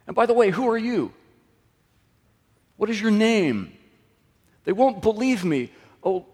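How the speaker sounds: high, anxious, pleading objections; panicky Moses. Retold or imitated. Preacher voices Moses pleading with God, high and anxious. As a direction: plead high and worried, ducking the job.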